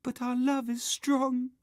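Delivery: very high, lovelorn wail; wailing vampire girl. A narrator's reading. Doomed film lovers declaring love, wailing. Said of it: "wailed the vampire girl".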